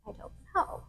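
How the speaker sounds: breathy sheepish whisper, small voice. Retold or imitated. Whispering to her partner that she has no answer. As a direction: confess it in a hushed whisper. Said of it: "I was like".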